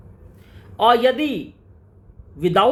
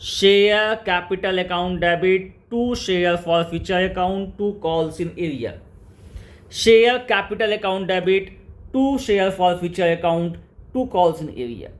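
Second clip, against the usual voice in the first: very slow, measured and lower-pitched; slow dictation; 5 semitones lower, much slower than usual. Shown as a demonstration. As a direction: dictate it term by term for students to memorize. Teacher dictates entry to memorize. (धीरे-धीरे, नीची आवाज़ में लिखवाते हुए)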